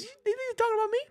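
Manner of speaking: mock the coach's oblivious chuckle. Streamer mimicking the coach hearing fans chant fire Napier, high mocking voice. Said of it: "He was laughing".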